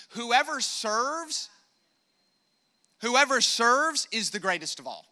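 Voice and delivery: slow and weighty on "Whoever", build on the repeat; emphatic repeat. Acted. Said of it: "preacher repeating key line".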